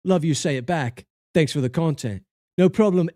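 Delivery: ordinary talk, nothing marked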